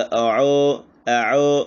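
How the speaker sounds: अओ said with lips unrounded; flat-lipped अओ; unrounded wrong way. Shown as a demonstration. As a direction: demonstrate the mistake अओ. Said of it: "बग़ैर होंठों को गोल किए हुए".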